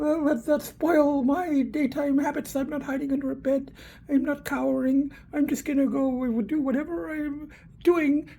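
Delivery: a hint of a stubborn cat; Geetha defiant. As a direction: voice the fierce cat refusing to hide. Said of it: "She's the one who's, like, going".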